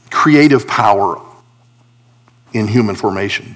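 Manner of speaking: ordinary delivery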